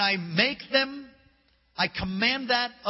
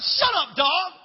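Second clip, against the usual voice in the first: loud, higher shut up; retold sharp retort; snapping back; 10 semitones higher, 6 dB louder, slower than usual.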